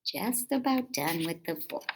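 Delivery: plain reading voice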